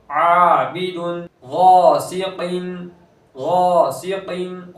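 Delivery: slow chant, heavy full गैन and lengthened alif; tajweed recitation. Shown as a demonstration. Teacher chants example words slowly for the class. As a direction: stretch each word out like Quran recitation. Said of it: "गैन ... पूर करेंगे".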